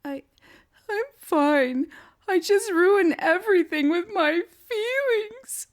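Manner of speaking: high, loud teary whimper of a little spirit; tiny spirit voice, high and tearful; whimpering creature. A narrator's reading. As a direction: whimper it like a weepy cloud creature. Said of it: "the creature whimpered".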